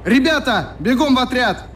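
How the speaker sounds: high urgent calling out; rally the boys to run, fast. Acted. Олег calls the drummers to run to the squad, urgent shout. Олег raises alarm, urges everyone.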